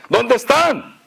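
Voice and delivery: mock yell to absent subjects; mock shouting. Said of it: "empezó a gritar, ¿no? A los súbditos".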